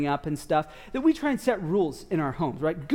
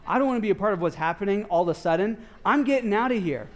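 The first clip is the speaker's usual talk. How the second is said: hurried retort; faster than usual. Retold or imitated. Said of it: "they were like".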